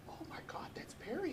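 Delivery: hushed, breathy whisper of recognition; a startled aside, whispered under the breath; surprised whisper. Retold or imitated. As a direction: whisper it as if he might overhear. Seeing Perry again, hushed aside.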